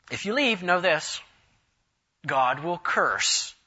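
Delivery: loud, emphatic pronouncement of a curse; dire warning. Acted. Farel warning Calvin not to leave the city, loud dire warning. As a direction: thunder the threat, slowing heavily at the end.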